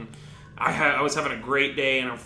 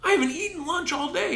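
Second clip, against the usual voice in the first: defensive plea. Retold or imitated. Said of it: "And I said".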